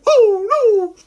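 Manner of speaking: very high, loud mock-frightened wail; scared little brother. Showing kids how little brother gets scared, high frightened wail. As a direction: wail it like a terrified little kid. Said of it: "scare my little brother".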